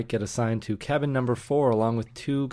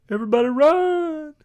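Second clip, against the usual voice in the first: mock yell; 7 dB louder than usual. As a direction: yell a hammed-up warning to everyone. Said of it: "Billy yells".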